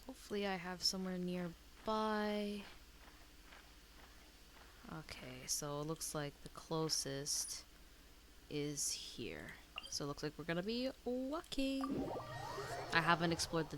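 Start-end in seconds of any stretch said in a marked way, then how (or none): none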